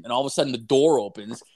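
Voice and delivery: ordinary talk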